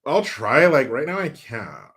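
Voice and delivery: exaggerated Valley Girl whine. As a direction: do a mock Valley Girl accent. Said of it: "Please do a Valley Girl".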